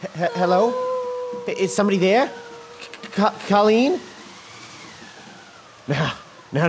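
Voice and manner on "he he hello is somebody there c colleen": hesitant, stammered call; tentative questions rising at the end; nervous calling out. Acted. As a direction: call uneasily to whoever might be there. Nervous call into empty hall.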